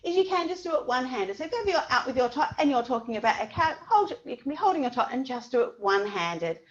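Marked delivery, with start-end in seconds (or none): none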